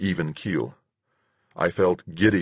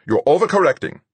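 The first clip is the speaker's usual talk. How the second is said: high, loud, sharp correction; 10 semitones higher, 6 dB louder, faster than usual. A narrator's reading. Fuchs correcting the struggling pilot over the radio, sharp. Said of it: "Fuchs said sharply".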